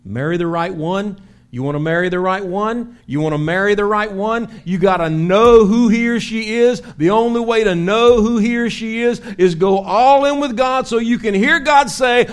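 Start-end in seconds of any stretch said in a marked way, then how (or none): 2.2-12.2 loud, high, driving sermon voice; full-force preaching. Acted. Pastor drives home marrying the right one, preaching full force. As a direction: preach it, building to a shout.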